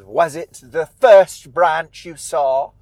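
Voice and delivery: put-on slow, loud old witch questioning; imperious witch voice; Old Widow Holcomb. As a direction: interrogate with theatrical, stretched words. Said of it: "Holcomb tells Lara to go back there".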